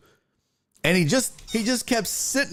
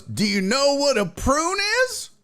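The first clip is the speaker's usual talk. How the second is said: patient grandpa voice; 10 semitones higher than usual. Retold or imitated.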